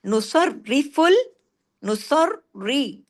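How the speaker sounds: नुसर्रिफुल slow, first रा heavy, second light; slow tajweed demo; doubled ra. Shown as a demonstration. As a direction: split the doubled रा, heavy then thin. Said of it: "यहाँ पे दो रा हैं".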